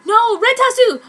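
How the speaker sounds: high, loud cry of alarm. A narrator's reading. Waitress crying out at spilled drink, loud. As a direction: shout it as the glass falls.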